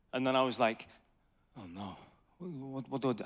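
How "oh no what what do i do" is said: very low, soft dismayed aside; retold hushed panic. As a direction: relive a quiet moment of dread. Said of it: "and I panicked".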